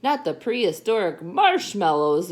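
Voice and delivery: loud, high exclamation; big hammed-up outcry; exclaimed. A narrator's reading. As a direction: exclaim it in storybook disbelief. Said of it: "exclaimed Skipito".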